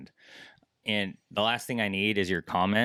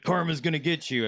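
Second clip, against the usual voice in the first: high-pitched sing-out warning; mock taunt; 8 semitones higher than usual. Anglers muttering about a rude boater, high mock taunt. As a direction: lift the voice in a mock threat.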